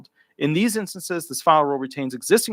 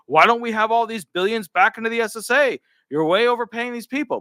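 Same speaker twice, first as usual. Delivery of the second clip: lightly mocking politician voice, pitched higher; mock Republican; 8 semitones higher than usual. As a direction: lampoon a scolding Republican. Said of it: "Republicans were like".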